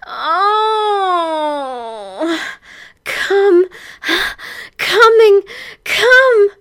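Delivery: long high wail, then loud cries; howling like a banshee. Acted. Heroine at her peak, howling high and loud. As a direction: howl at full pitch with gasps between. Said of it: "howls like a banshee".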